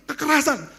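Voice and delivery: high-pitched mock outcry; retold cry. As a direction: call it out high, short of a shout. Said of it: "berteriak sama Tuhan". (seruan tiruan bernada tinggi)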